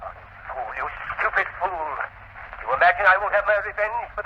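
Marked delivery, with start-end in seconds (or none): 0.0-1.9 hissed under his breath, furious; a furious whisper he can barely hold in; seething whisper. Acted. Professor seething alone after being fired, hissed whisper.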